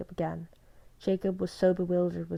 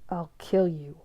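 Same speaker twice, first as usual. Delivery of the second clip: choked rage; slower than usual. A narrator's reading. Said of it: "choked with rage ... as low as a whisper".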